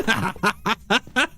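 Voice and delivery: high, giggly laugh; high chuckle at own joke; real laugh. Real, not acted. Laughs after aura joke.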